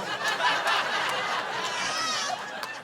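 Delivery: high burst of real laughter; laughing. Real, not acted. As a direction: let the laugh break out high. Teller laughs at own joke.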